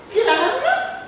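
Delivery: very high laughing question; squeaky Jackie. Acted. Jackie voiced. (Jackie con voce acutissima)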